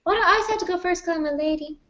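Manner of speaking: high, sped-up mock protest; mock complaint. Co-host asked to go first, mock indignant.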